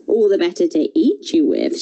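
usual speaking voice

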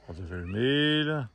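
normal talking voice

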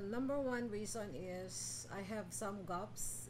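normal talking voice

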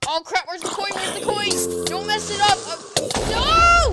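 high, loud, urgent shouting; flustered cries rising to a scream; frantic panic. Real, not acted. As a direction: scramble in panic, building to a yell. Frantically hunting lost coin.

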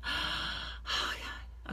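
gasp, then a whispered oh God; breathless gasp. Real, not acted. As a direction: catch your breath, overwhelmed. Overwhelmed at the start.

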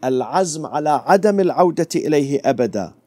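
rapid, recited Arabic "العزم على عدم العودة إليه أبدا"; Arabic recitation. Shown as a demonstration. Preacher quoting the second condition of repentance, fast Arabic. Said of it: "Number two".

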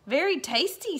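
high satisfied dog voice; pleased dog voice. Acted. Walter praising the biscuits to himself, high and pleased. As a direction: savour the treat as Walter. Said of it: "said Walter to himself".

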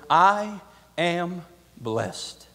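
each word stretched out and weighed; slow emphatic declaration. Acted. Paul's first words to Agrippa, slow and emphatic. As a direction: lay it down slowly, word by word.

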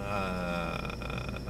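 usual speaking voice

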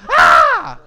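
high, loud scream; mock scream. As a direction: scream like startled boys. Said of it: "not to scream like that".

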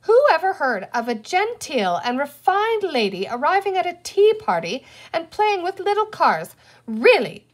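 high, loud exasperated scolding; crying out. A narrator's reading. Girl lectures her brother on tea parties, indignant. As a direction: protest grandly, as a scandalised big sister. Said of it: "Penny cried".

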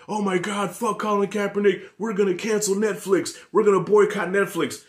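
hammed-up angry viewer voice; mock outraged MAGA fan. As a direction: parody an offended Trump supporter.

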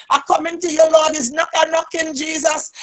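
usual speaking voice

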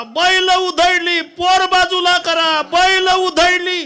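high, loud and slow calling out; old woman's loud retelling. Acted. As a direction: wail it out to the court.

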